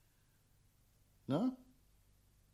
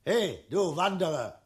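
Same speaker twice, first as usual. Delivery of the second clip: slow bellowing hail; taunting loud hail; loud slow call; 6 semitones higher, 9 dB louder than usual. Acted. Dragon bullies the wanderer.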